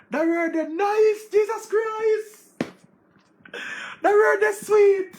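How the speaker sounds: high, slow, loud delighted cry; ecstatic praise. Real, not acted. Gushing over a big word, loud and high. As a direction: savour the word like candy.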